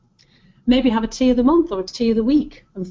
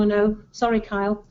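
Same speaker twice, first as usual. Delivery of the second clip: usual speaking voice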